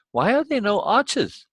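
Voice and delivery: put-on high-pitched boy's voice; young boy. A narrator's reading. Boy questioning the old man, high boy voice. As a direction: ask as a curious boy, voice pitched well up. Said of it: "the boy asks".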